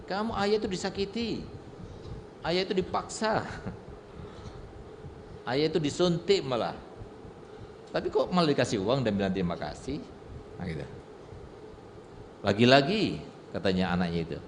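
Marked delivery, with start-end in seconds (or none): 0.1-10.0 put-on higher, softer indignant child voice; a son questions his father, higher and indignant; protesting child. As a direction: play the puzzled son objecting. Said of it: "Nggak terima itu anak itu".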